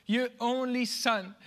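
normal talking voice

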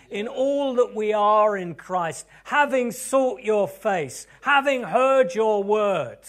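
rising prayer fervour; fervent preaching. Acted. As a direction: declare each phrase slowly and loud.

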